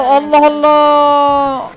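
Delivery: very slow, drawn-out, loud sigh of disbelief; exasperated groan. Real, not acted. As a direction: drag it out, fed up. Exasperated by noisy game enemies. (uzatılmış, bıkkın Allah Allah)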